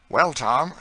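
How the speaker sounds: the master speaking up to his servant; Saint Clair's voice. Acted. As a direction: hail Tom brightly as the master. Saint Clair addresses Tom.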